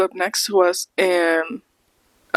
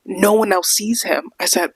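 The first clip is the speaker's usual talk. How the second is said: ordinary talk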